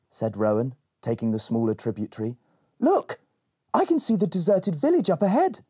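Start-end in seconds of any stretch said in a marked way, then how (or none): 2.8-5.6 excited spotting. Acted. Rowan spots the village.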